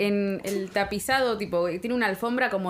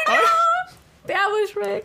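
much higher, laughing swoon voice; swooning princess; 12 semitones higher, much slower than usual. Retold or imitated. Mocking Fiona's love for Shrek, a high giddy laughing swoon.

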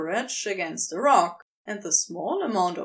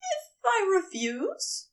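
hesitant question; 8 semitones higher than usual. A narrator's reading. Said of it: "he asks".

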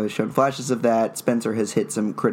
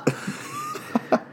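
airy laugh; 5 dB quieter than usual. Real, not acted. Laughs at the joke.

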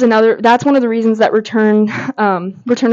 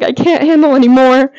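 overwhelmed cry; 4 semitones higher, 5 dB louder than usual. Retold or imitated. Recalling being overwhelmed by the scene.

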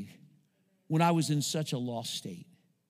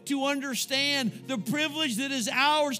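prayer; 4 semitones higher than usual. Acted. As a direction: pray over the congregation with awe. Pastor leads closing prayer.

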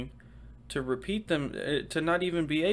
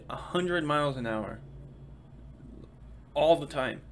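ordinary talk, nothing marked

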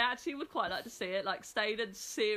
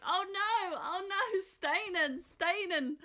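high pleading oh no; mock panicked whimper; 8 semitones higher, slower than usual. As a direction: whine her name in mock panic. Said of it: "You'd be all like".

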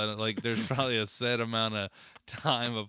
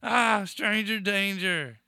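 put-on confused old man; 10 semitones higher, 6 dB louder, slower than usual. Faking Alzheimer's bit, acted confusion.

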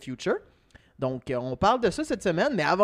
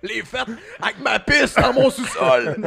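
laughing; 7 semitones higher, 7 dB louder than usual. Acted. Mock party host.

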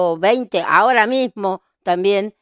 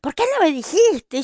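reproach; 10 semitones higher than usual. Retold or imitated. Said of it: "Cristina la repu".

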